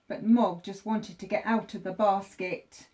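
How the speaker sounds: usual speaking voice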